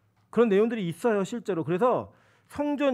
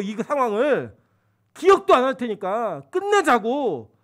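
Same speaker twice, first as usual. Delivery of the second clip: louder, slow, exasperated pleading; frustrated father; 4 semitones higher, 7 dB louder, slower than usual. Retold or imitated. Father pleading loudly with crying son to end it. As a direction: drag each word out to a sobbing kid, firmly.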